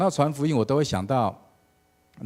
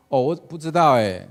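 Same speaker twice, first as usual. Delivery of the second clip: loud, higher call from the crowd; congregation calling back; 4 semitones higher, 9 dB louder than usual. Retold or imitated. The congregation calls out to the nervous pastor, loud and high. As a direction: shout the answer back as the whole room.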